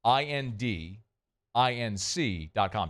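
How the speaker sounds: slow, deliberate web address with pauses between chunks; slowly spelled out. Real, not acted. Host giving listeners a hard-to-remember website, slowly spelled out. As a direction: spell it out piece by piece so it can be remembered. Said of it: "I say it slowly".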